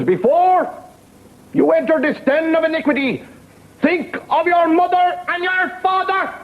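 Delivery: high, drawn-out moralizing nun; preaching nun. Acted. Nun warns a man entering a bar, high-voiced preaching. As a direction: plead loftily against sin in a high voice.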